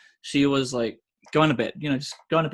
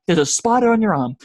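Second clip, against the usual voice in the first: louder, higher warning call; retold alarmed warning; 6 semitones higher, 7 dB louder than usual. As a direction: re-enact calling out a danger.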